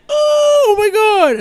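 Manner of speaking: high, slow mock gasp. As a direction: shriek it out, amazed. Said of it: "two guys are like".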